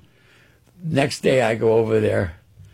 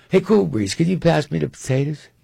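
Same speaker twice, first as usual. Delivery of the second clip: lightly raised Cotton voice; casual ask at dinner, a bit higher; imitating Cotton; 6 semitones higher, 4 dB louder than usual. Cotton asks the son for potatoes, lightly voiced higher. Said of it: "he goes".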